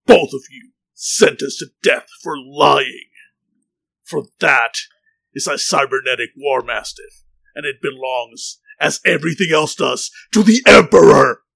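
booming condemnation. Acted. Soldier sentencing two liars to death, booming and building. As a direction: pass sentence like a zealot, building to the last word.

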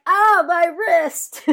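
high, drawn-out mock ow; mock pained cry. Acted cry of pain.

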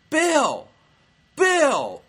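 high, loud mock screaming; imitated drunken yelling. Drunk heckler calling out to Bill on stage, yelled imitation. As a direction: yell like a drunk heckler at a show. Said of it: "starts yelling and screaming".

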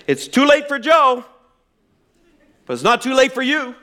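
mock fire-and-brimstone pastor; blunt preacher. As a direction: hammer it home like a tactless pastor. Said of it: "so insensitive to everyone who was mourning".

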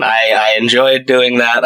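ordinary talk

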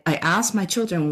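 ordinary delivery

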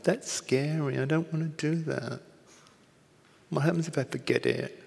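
high-pitched, mock-timid little voice; small self. Small self balking at Raven's teaching, mock timid. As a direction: play the reluctant, worried small self. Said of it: "Me, my small self".

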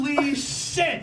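high, slow, stretched holy shit; shocked outburst. Acted. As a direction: gasp it out in disbelief. Improv character's shocked outburst.

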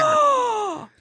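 big, high theatrical gasp; dramatic gasp. Pretend. Friend reacting to the eyebrow story, dramatic gasp. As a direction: gasp as if scandalized. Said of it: "dramatic gasps all around".

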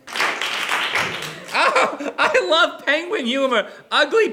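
high voice laughing through the words; amused laugh. Real, not acted. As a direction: laugh while you say it. Laughing at the act title.

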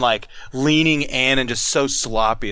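ordinary talk

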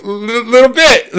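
high, breaking voice with a laugh in it; a little choked up. Retold or imitated. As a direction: admit it through a lump in the throat. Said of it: "Are you getting choked up?".

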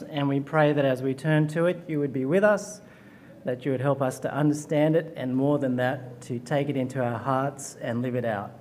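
soft, sinking opening prayer; quiet earnest prayer to open the sermon. Real, not acted. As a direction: pray it quietly and reverently to God.

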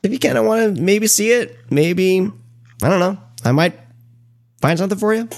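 high-pitched, playfully noncommittal offer; coy. Real, not acted. Host hinting he might find the film for listeners, coy. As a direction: coyly dangle a favor in a high voice. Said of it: "You're so coy".

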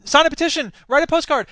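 high, loud, punchy hailing of passers-by; calling out. Retold or imitated. Activist at a card table rounding up friends, calling out. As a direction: hail friends over eagerly, pitched well up.

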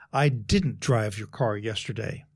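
"didn't" hit hard, the rest falling away; stress on DIDN'T. Shown as a demonstration. Coach showing how emphasis changes meaning, stress on "didn't". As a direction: punch the word "didn't", slowly. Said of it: "change the emphasis on any one".

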